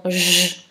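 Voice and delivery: J held as a buzzing "jjj"; isolated sound. Shown as a demonstration. Teacher explaining the rule for G, J held as "jjj". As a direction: sound out J alone, not the letter name. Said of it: "fait le son J".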